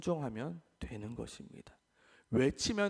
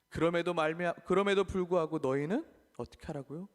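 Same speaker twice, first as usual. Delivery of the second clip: ordinary delivery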